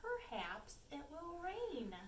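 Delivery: a hint of a gentle frog, drawn out. Frog in the bog answering, lightly voiced and slow. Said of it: "The frog in the bog says".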